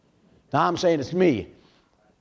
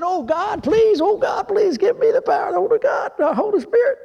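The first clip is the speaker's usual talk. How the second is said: high, tearful pleading to God, voice breaking; crying prayer. Retold or imitated. Young preacher retelling his prayer, crying out high and tearful. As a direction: beg God through tears, high and strained.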